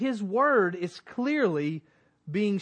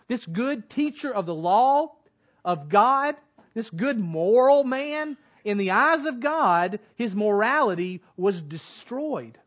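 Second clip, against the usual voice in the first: louder, higher preaching build; rising preaching; 3 semitones higher, 6 dB louder than usual. Acted. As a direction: drive the point home to the congregation.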